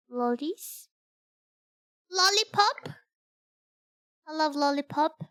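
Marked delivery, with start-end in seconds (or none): 2.1-5.2 put-on kid voice. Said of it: "Voice to sound like a kid".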